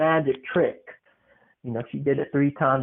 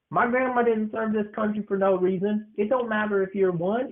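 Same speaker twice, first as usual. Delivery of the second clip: ordinary delivery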